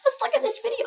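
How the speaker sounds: put-on high, soft little old woman voice; faint old lady whisper. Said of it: "We're gonna talk like little old women".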